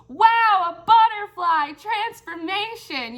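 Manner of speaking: high, slow gasp of wonder; awestruck wonder. Retold or imitated. Reader recalling a butterfly in a reading, high awestruck wonder. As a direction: marvel at the butterfly like a child. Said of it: "and I was like".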